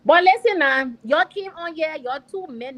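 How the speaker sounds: loud, slow, indignant; angry outburst. Real, not acted. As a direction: burst in, furious and loud. Guest confronts hosts angrily.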